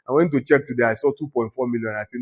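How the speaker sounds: usual speaking voice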